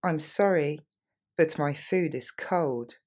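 slow, careful model sentence; unhurried teacher demo; slowly. Shown as a demonstration. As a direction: draw each word out for learners. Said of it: "Et on le reprend lentement".

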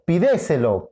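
"pídeselo" stressed as pi-DE-se-lo; stress on second syllable. Shown as a demonstration. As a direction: move the accent of "pídeselo" onto DE. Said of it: "¿Cuál es?". («pídeselo» con acento en DE: pi-DÉ-se-lo)

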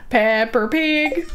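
slow, exaggerated American "Pepper Pig"; American accent. Shown as a demonstration. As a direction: draw it out in a playful American accent. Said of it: "With an American accent".

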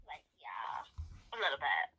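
soft, breathless whisper aside. Acted. Guest whispering a sly aside to the host, whispered. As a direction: lean in and whisper it.

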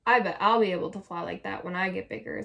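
usual speaking voice

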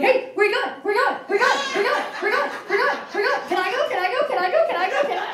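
high, loud, rapid-fire eager pleading; an overeager puppy begging to come along; needy dog. Pretend. A dog pestering its owner, high, loud and eager.

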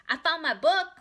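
put-on high, very loud little-girl cry; triumphant child. A narrator's reading. Ramona shows Beezus her find, loud and triumphant. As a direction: crow over a great find.

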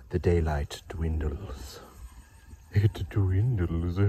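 very slow mock-ominous intoning; dramatic narration.

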